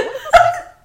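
mock confused what. Confused by hidden dishwasher door.

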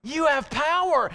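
high, drawn-out rallying declaration; bold self-affirmation. Shown as a demonstration. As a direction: declare it to yourself with full conviction. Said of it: "you're gonna say to yourself".

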